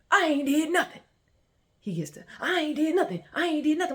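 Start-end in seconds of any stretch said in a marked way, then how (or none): 0.1-1.0 high, loud protest imitating him; loud denial. Imitating him denying it to her, loud protest. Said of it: "he's denying it". 2.4-4.0 high, loud protest imitating him; loud denial. Imitating him denying it to her, loud protest. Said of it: "he's denying it".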